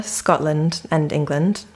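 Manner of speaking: ordinary delivery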